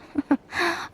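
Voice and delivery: short high mocking laugh; a dry scoffing chuckle; scornful laugh. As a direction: laugh off the whole notion with contempt. Lady Ai mocks still-mind ideal.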